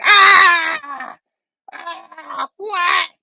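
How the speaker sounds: high-pitched mock villain scream, tailing off; Goblin's startled scream. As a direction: shriek loudly, then squeak in disbelief. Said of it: "He's like".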